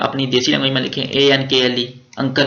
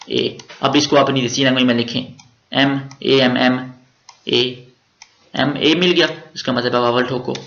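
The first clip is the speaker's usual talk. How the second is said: normal talking voice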